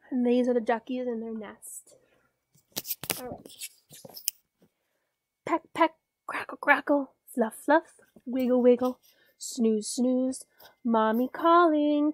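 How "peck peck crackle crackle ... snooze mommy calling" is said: bouncy, playful sing-song reading; reading to a toddler. A narrator's reading. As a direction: pop each little word for a toddler. Reads duckling poem to child.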